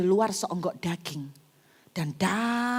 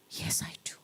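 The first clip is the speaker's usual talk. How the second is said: weak whispered reply; 11 dB quieter than usual. Retold or imitated. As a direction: breathe the answer out, barely there. Sick woman answering weakly. (berbisik lemah)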